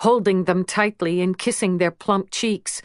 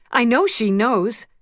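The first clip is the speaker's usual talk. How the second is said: plain reading voice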